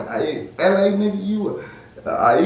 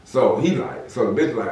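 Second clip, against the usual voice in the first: normal talking voice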